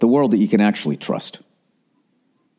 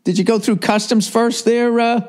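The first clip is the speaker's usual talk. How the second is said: higher, sarcastic official voice; mock customs officer; 12 semitones higher than usual. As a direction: quiz her like a smug border guard. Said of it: "I wanted to blow up".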